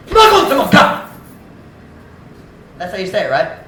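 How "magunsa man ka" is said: sharp, loud scolding snap; high loud scolding yell; harsh rebuke. Retold or imitated. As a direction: bark it at the small mistake. Preacher acts harsh rebuke.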